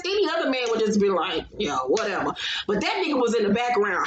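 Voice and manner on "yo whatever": low, unbothered dismissal; low-pitched mock nonchalance; indifferent, imitated. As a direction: drop your voice and shrug it off. Voices an unimpressed man.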